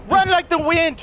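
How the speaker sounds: urgent cheering. Real, not acted. Player to fleeing teammate, urgent cheering. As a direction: urge the runner on.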